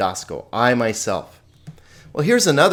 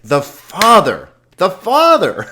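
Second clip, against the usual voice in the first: "Father" punched loud, higher on the repeat; emphatic stress on Father; 7 semitones higher, 7 dB louder than usual. Shown as a demonstration. Teacher shows how the Greek emphasizes, booming stress on "Father". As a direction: hammer "Father", drawn out and loud, building. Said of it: "this Father very emphatic".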